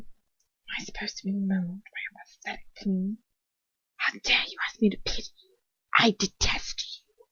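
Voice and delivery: soft, hissed disdain; contemptuous whisper. Acted. Lucius sneers at the captive's plea in a whisper. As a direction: whisper your loathing down at her.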